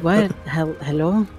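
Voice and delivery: ordinary delivery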